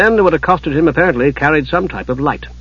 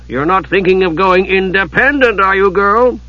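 mildly threatening, higher-pitched question; ominous; 6 semitones higher than usual. A narrator's reading. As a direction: probe with a hint of warning. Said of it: "in an ominous tone".

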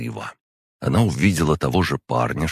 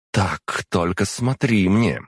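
higher-pitched, drawn-out caution; jealous warning; 6 semitones higher, slower than usual. A narrator's reading. Demon cautions him about her sister, jealous warning. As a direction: warn him off like a jealous girlfriend. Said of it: "снова включила ревнивую подругу".

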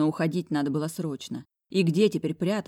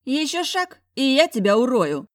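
threatening; 8 semitones higher, 7 dB louder than usual. Acted. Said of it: "Голос зайки прозвучал глухо".

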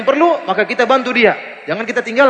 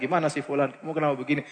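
much softer, confiding question; gentle private advice; 6 semitones lower, 12 dB quieter than usual. Retold or imitated. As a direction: speak as if quietly on the phone to a friend. Private phone advice to a friend. (menasihati teman lewat telepon, suara pelan dan lembut)

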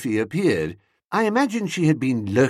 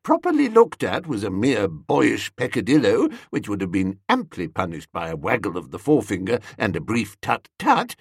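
ordinary delivery, nothing marked

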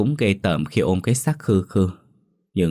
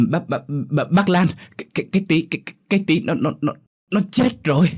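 high, frantic stutter; panicked stammering; 9 semitones higher, faster than usual. Acted. Child bringing news of a death, panicked stammering. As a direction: stumble over each word in shock, pitch raised. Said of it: "đến nỗi nói lắp bắp".

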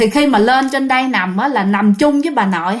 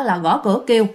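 ordinary delivery, nothing marked

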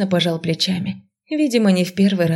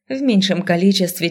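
usual speaking voice

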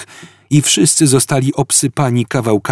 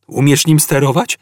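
hopeful, raised-pitch question; 5 semitones higher than usual. A narrator's reading. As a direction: ask with a touch of hope. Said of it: "spytał Ford z nadzieją".

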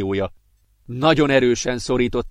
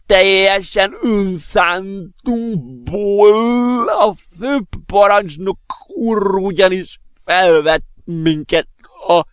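high, drawn-out put-on professor voice; cheerful rambling in a high, slow, halting voice; merrily stammering professor; 7 semitones higher, 4 dB louder, much slower than usual. A narrator's reading. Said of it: "Dadogott vidáman a professzor". (vékony hangon, vidáman dadogó professzor)

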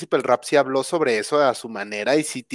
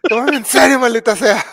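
high, loud mock outburst; hammed-up exasperated yell; mock shout; 7 semitones higher, 4 dB louder than usual. The trailer grabbing him by the neck, mock shouting. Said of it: "me agarró del cuello y me gritó".

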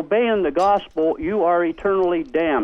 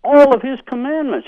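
heated; 5 semitones higher, 8 dB louder than usual. Real, not acted. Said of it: "calm down".

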